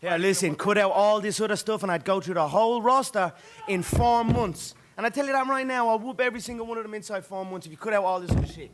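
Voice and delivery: loud, high fired-up boast; shouting. Real, not acted. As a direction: yell the challenge across the room. Heated boast, raised voice.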